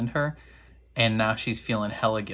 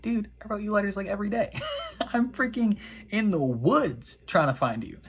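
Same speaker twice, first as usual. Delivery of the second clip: high-pitched, put-upon protest; mock exasperated defence; 8 semitones higher than usual. Boy defending himself to the girl he tracked, mock exasperated. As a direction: plead your case in a high, hammed-up voice. Retold defence in lovers' quarrel.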